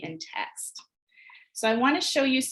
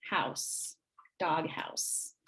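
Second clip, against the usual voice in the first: whispered syllables, much softer; soft segmenting; 5 semitones lower, 9 dB quieter, much slower than usual. Shown as a demonstration. Teacher quietly naming each syllable to students, whispered. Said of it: "pull down the manipulatives".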